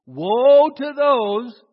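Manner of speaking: loud, drawn-out "Woe"; slow loud proclamation; call to repeat. Shown as a demonstration. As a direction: lead the congregation in a slow, booming warning. Models a line for congregation to repeat.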